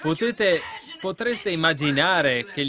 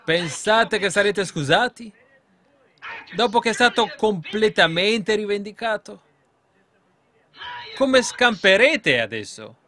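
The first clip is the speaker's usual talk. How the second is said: loud, raised voice pressing accusing questions; forceful preaching; 4 semitones higher, 4 dB louder than usual. Real, not acted. Preacher confronting the congregation with their guilt, forceful preaching. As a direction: preach it hard, driving each charge home.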